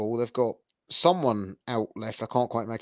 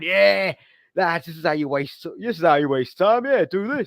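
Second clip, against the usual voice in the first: put-on big booming Yorkshire accent, higher and louder; David Stockdale impression; 6 semitones higher, 4 dB louder than usual. Stockdale telling the lads how to waste time, booming Yorkshire accent. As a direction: boom it out like a Yorkshire goalkeeper coach down the pub. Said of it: "he's got that big booming Yorkshire accent".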